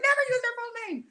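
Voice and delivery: high-pitched mock warning; imitated husband. Husband warning her about privacy, high mock scolding. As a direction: squeal it as a playful scolding. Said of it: "My husband's like".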